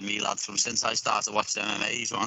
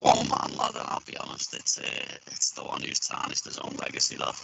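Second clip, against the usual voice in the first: glitchy, robotic voice over a bad connection; robotic audio; 12 semitones lower, 3 dB quieter than usual. Real, not acted. Fighter answering over a failing call line, robotic glitching audio. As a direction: sound like a breaking digital line. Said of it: "you've sounded robotic".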